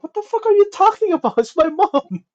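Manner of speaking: a mock yell of disbelief; mock outraged yell. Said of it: "I'm like".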